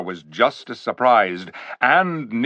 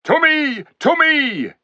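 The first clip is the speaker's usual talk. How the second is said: much higher, louder rallying call; 4 dB louder, slower than usual. A narrator's reading. King calling the few animals, loud. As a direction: summon the animals to you. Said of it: "he called".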